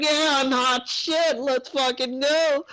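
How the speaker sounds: high, slow cocky boast; cocky swagger. Retold or imitated. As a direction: strut and brag in a high mock voice. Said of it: "you guys are like".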